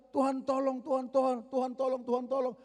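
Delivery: high, loud, pleading cry; desperate plea. Acted. Mother crying out to Jesus for her possessed child, high and pleading. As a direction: beg him again and again for help.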